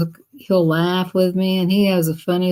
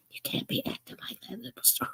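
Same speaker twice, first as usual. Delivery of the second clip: whisper; 5 semitones higher, 17 dB quieter, faster than usual. Retold or imitated. Retold scolding, dropped to whisper.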